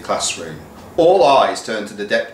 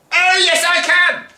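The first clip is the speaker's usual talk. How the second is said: very high, louder defiant yes; teacher yelling at the school; pantomime retort; 5 dB louder than usual. Acted. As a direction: shout back like a panto villain. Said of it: "pantomime routine".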